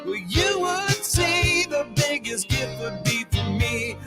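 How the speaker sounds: ordinary talk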